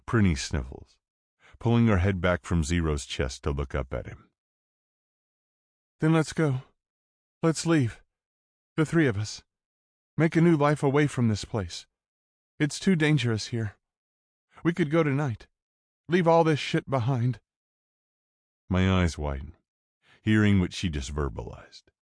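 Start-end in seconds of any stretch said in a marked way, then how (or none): 6.0-17.4 put-on high, pleading teen girl; urgent sister, voice raised high; young woman voice. A narrator's reading.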